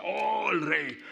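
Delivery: falsa admiración, alta y fuerte; reverencia burlona. Acted. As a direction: exagera un homenaje de mofa. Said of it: "en burla le hacían reverencia". (burla con reverencia fingida)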